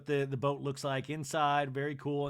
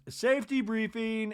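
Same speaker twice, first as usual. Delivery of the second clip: mock announcement; 8 semitones higher, 5 dB louder than usual. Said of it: "Garcelle inside goes".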